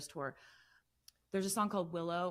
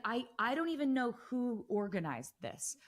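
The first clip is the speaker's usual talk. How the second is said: amazed reaction; 4 semitones higher than usual. Retold or imitated. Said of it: "I'd just be like".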